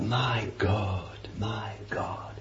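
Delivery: hushed, breaking cry of despair; a dying man's anguished whisper. Retold or imitated. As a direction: whisper it out as if forsaken. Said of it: "in the darkness he cried out".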